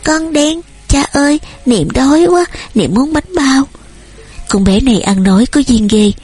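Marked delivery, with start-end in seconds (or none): 0.0-3.7 put-on high, small child voice; toddler babble. A narrator's reading. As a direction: prattle to daddy like a hungry toddler. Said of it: "nó bập bẹ".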